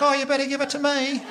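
very high mock female voice; amorous woman next door. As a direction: squeal it as a hammed-up lover. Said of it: "and she's like".

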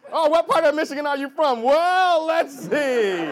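imitating Michiganders. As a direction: play an over-eager Michigan local, loudly. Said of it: "people from Michigan always, like, do this".

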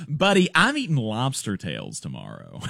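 mocking the congressman's lobster brag. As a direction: parody his carefree brag. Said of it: "the first thing that you say".

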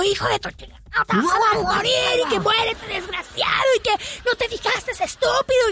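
hammed-up road-rage screaming; mock furious yell. Wife yelling at other driver.

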